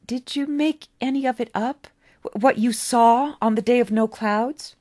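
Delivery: put-on a curious boy who can't hold back; eager boy David. David presses the old man about his vision, eager boy voice.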